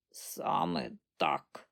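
low, slow croaky granny; old woman's croak. A narrator's reading. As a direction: croak it out slowly. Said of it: "квакнула вона".

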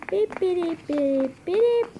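soft, high-pitched beeps; quiet high beep noises; imitated beeping. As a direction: make little electronic beeps with your voice. Imitates beeping while playing.

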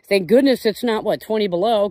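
usual speaking voice

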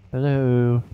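long, drawn-out hello; calling out. Real, not acted. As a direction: call out, stretching the word. Calling out into empty game.

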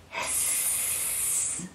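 breathy hiss with no voice; cat hiss. Shown as a demonstration. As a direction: show the kids an angry cat's hiss. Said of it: "go hiss, just like this".

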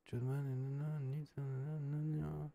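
soft skimming mumble; mumbled reading. Real, not acted. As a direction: mumble through it to yourself. Skimming an English document aloud.

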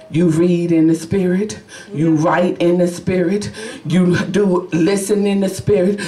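ordinary talk

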